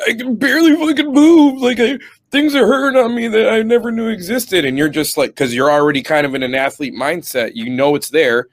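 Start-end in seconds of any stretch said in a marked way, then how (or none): 0.1-4.6 high, loud mock whine; exaggerated high-pitched complaining, imitating a recruit; whining sore recruit. Sore recruit moaning in the first week, mock high whine.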